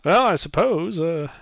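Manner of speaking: high-pitched woman, friendly; lightly voiced middle-aged woman. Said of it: "middle-aged woman".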